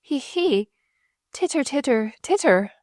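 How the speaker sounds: high, lightly giggled words; giggling. A narrator's reading. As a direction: read them as high titters. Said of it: "cackle of hysterical laughter".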